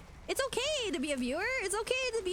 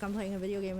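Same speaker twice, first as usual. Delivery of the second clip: mock bored streamer; 9 semitones lower, faster than usual. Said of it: "they're like".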